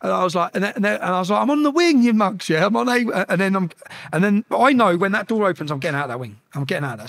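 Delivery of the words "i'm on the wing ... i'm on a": high, raised-voice taunt; retold defiant shout. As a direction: call it out defiantly through the window. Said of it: "having all this through the windows".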